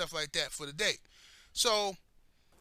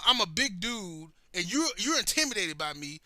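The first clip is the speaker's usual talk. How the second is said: normal talking voice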